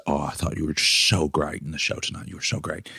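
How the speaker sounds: a hushed Southern compliment; Southern accent. Retold or imitated. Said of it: "she's got that Southern accent".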